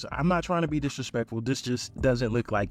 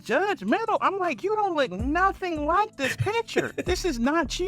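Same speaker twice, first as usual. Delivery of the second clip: disbelieving. Retold or imitated. Man on a catfish date, squeaky incredulous retort.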